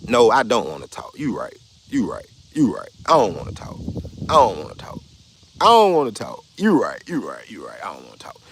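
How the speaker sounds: mock surrender, repeating it to shut the argument down; sarcastic brush-off. Streamer brushing off a man arguing in chat, sarcastic.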